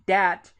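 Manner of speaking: "dat" starting on a hard D, not TH; Southern D for TH. Shown as a demonstration. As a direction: say "dat" the New Orleans way, with a D. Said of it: "dat with a D instead".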